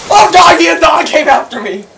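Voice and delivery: high, loud alarm easing off; startled yell. Real, not acted. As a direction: yelp as a dog rushes at you. Attacked by dog in game.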